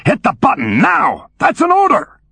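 barking order rising to a yell; commander's shout. Acted. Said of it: "A different voice, not so cuddly".

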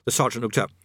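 plain reading voice

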